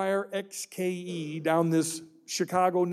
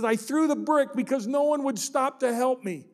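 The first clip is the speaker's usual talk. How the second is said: put-on higher, tearful child's plea; pleading child; 6 semitones higher than usual. Crying boy begging the driver to stop and help, tearful plea. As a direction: beg the stranger like a crying boy. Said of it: "the kid's just starting to cry".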